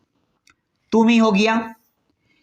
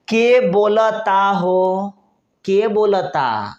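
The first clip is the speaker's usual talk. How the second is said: slow Bhojpuri ke bolta ho, stress on के; Bhojpuri phrase; 3 semitones higher, much slower than usual. Shown as a demonstration. Teacher showing a Bhojpuri chat phrase, slow Bhojpuri. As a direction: drawl it the way a Bhojpuri speaker asks. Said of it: "कोई भोजपुरी अच्छा बोलता है".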